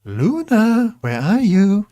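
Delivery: high sing-song pet call; a sing-song high call, hunting for the cat. Real, not acted. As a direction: call out high, searching for her. Searching for the lost cat.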